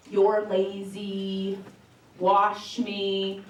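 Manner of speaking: slow, deadpan readout of a dirty-car note; slow reading of a scrawled message. Pretend. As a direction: drag each word out slowly like reading dust graffiti. Reading a note written in dust.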